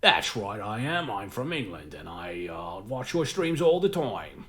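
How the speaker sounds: hammed-up mock Cockney caller; bad English accent. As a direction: lay on a fake English accent, stretching the last words. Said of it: "they'll just do a really bad, like, accent".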